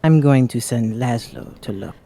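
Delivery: ordinary talk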